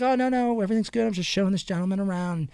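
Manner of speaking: reassuring sheriff. Retold or imitated. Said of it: "he's like".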